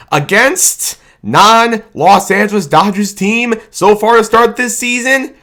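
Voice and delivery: normal talking voice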